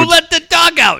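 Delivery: high, loud casino holler; loud, high-pitched mimicked shout; imitated gambler's yell. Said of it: "and yell out, yell out".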